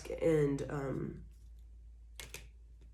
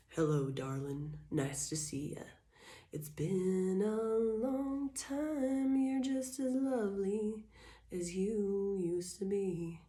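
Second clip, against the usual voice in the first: sweet-talking ex, softer, slow; smooth charming ex voice; 4 semitones higher, 6 dB quieter, slower than usual. Retold or imitated. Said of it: "energy of".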